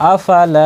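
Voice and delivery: আফালা, lingering on the last লা; drawn-out final vowel. Shown as a demonstration. Teacher repeating the madd for learners, final লা drawn out. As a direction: hold the লা of আফালা for a full beat. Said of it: "টানতে হবে".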